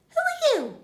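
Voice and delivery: very high, loud prim question; lady burglar. Acted. Lady burglar returns the question, high. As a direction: toss it back sweetly. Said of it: "says the lady".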